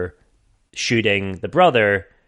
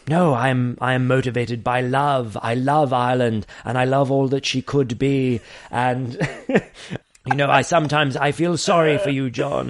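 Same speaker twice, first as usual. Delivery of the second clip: mock impeccable English accent, slightly raised pitch; received pronunciation; 3 semitones higher than usual. Terry declaring his love of Ireland to John, in received pronunciation. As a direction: send up an earnest English gentleman in RP. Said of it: "Terry, in impeccable English accent, you know, received pronunciation".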